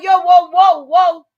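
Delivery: loud, high call to stop; shouted whoa. Retold or imitated. Speaker interrupts a woman at the hearing, shouting.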